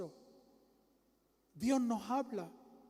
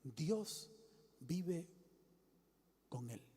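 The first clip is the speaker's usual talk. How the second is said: softer, broken into single words; preacher voicing God's voice in a hush; hushed heavenly voice; 5 semitones lower, 7 dB quieter, much slower than usual. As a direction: let each word fall quietly. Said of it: "Oí una voz que decía".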